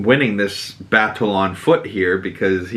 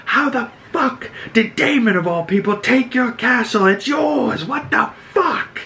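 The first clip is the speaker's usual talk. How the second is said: high-pitched strained yell, held back in volume; imitating an outraged king's yell; mock yelling; 14 semitones higher than usual. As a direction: act out a furious tirade, pitched way up. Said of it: "he is yelling at Larys Strong".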